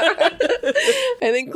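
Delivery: high, laughing hard. Real, not acted. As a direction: laugh through it. Laughing fit.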